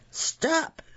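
high-pitched command to halt; yelled. A narrator's reading. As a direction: cry out the order, pitch way up. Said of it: "yelled Prince Abel".

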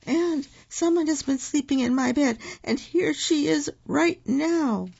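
very high wee little voice; tiny cub piping up in protest; high, squeaky-small bear voice; baby bear. A narrator's reading. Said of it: "in his wee little voice".

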